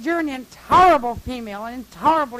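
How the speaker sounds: loud, indignant imitation of a scolding man; retold screaming. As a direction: hit "intolerable" hard in mock outrage. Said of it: "he's screaming at me".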